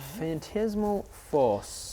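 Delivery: slow New Zealand "Phantasmal"; Kiwi accent. Real, not acted. Game master reading out the spell name, Kiwi accent. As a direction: say "Phantasmal" with a touch of Kiwi vowel. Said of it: "You just do a wee Kiwi accent".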